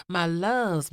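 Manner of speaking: ordinary talk, nothing marked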